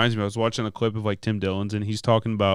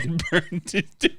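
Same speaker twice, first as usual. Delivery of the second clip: laughing; 7 semitones higher, slower than usual. Real, not acted.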